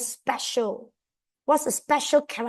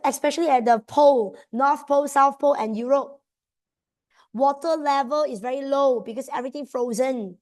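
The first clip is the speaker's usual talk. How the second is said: normal talking voice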